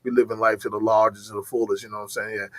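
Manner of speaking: mock deep, low monotone voice; low-pitched dude-voice parody; Brittany Griner impression. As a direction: drop low and laid-back, imitating a deep-voiced athlete. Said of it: "that motherfucker's voice is just".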